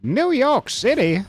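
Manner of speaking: high, slow, loud mock question; mock surprise. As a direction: squeal it in fake disbelief. Joking retort, high and loud.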